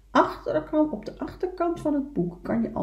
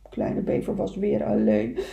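usual speaking voice

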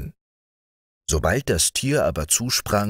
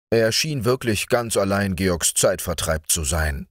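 ordinary delivery

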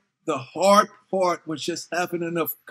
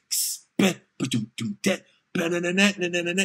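beatbox; 3 dB quieter than usual. Shown as a demonstration. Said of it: "It was supposed to go".

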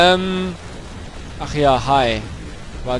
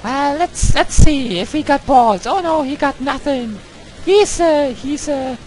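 high put-on English girl voice, raised all through; a falsetto girl persona ranting in English at the game; 6 semitones higher, 3 dB louder than usual. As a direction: play a loud, squeaky English girl character in English. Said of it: "I'm playing the English girl right now".

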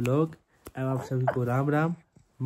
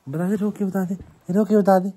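1 coaxing call; 5 semitones higher, 7 dB louder, much faster than usual. Real, not acted. Coaxing child to cry on camera.

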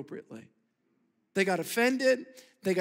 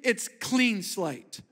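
slow emphasis; much slower than usual. Acted. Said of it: "slow emphatic statement".

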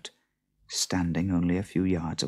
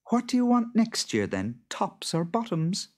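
higher-pitched wife voice with lively swoops; farmer's wife, reasonable; 14 semitones higher than usual. A narrator's reading. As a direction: propose a clever deal in a high voice.

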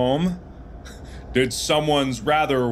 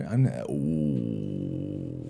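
pained groan; 8 dB quieter, much slower than usual. Real, not acted.

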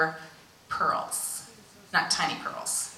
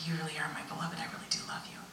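soft, tender whisper; God whispering love to her; loving whisper; 10 dB quieter, faster than usual. Retold or imitated. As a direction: breathe it with love. God's loving words.

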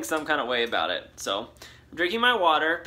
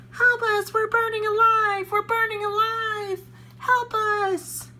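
shrieking kale voices crying for help; burning scream; 5 dB louder than usual. Acted.

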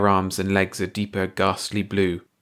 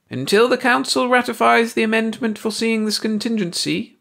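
explaining alien voice; 14 semitones higher, 3 dB louder than usual. A narrator's reading. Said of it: "Baldy explained".

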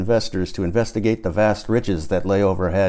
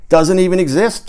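sudden loud yell, a little higher; 9 semitones higher, 6 dB louder than usual. A narrator's reading. Tom yelling at the table, suddenly and smokily. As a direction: shout it out, smoky from the cigarette. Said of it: "Tom yelled suddenly and smokily".

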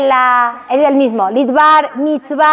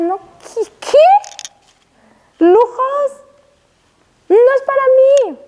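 high, surprised rabbi refusing luxury; shocked refusal; 8 semitones higher, slower than usual. Retold or imitated. Teacher voicing Rav Kanievski refusing a house, shocked.

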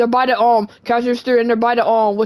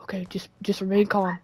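low-volume murmur while hiding; hushed self-calming; 3 semitones lower, 8 dB quieter than usual. Pretend. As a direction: keep it very soft so nobody hears. Hiding from store staff.

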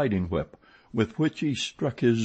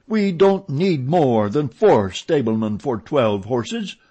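slow, loud and higher, emphatic; angry, laboured insistence at raised volume; roared; 6 semitones higher, 7 dB louder than usual. A narrator's reading. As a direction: roar it out word by word. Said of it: "roared Richard".

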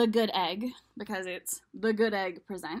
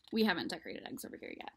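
usual speaking voice

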